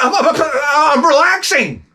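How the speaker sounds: high, stuttered, drawn-out mock protest; mock yelled protest. Husband sinking in the pool answers wife, mock yelled protest. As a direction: sputter it back in a stretched high voice.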